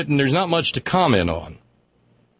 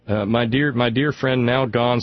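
ordinary talk, nothing marked

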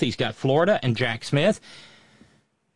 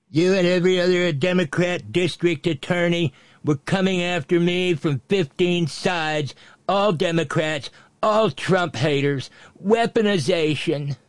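quoting aloud; 3 semitones higher than usual. Acted.

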